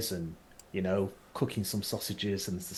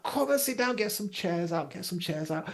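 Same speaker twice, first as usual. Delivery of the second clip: higher, bustling hostess; Belle's eager welcome; 7 semitones higher than usual. Acted. Belle welcomes the crew to the barbecue, high and eager. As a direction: hurry them to sit down. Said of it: "Belle starts kind of like".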